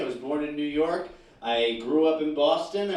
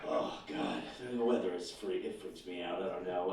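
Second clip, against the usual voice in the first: quiet; 8 semitones lower, 9 dB quieter than usual. Acted. Mock dread about weather.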